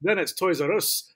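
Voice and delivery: slow mock-Scottish "Toys R Us"; Scottish accent. Joking about how Scots say it, mock Scottish accent. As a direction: put on Scottish vowels, drawing it out. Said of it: "Unless they're Scottish".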